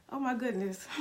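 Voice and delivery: fighting back real tears; holding back tears. Real, not acted. Thanking her girlfriend for a car, close to tears. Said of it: "I'm not gonna cry".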